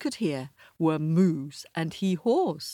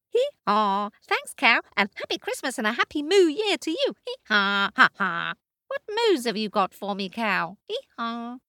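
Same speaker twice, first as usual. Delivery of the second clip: much higher, brisk braying donkey; 7 semitones higher, 4 dB louder than usual. Acted. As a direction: bray and chatter cheerfully. Donkey character.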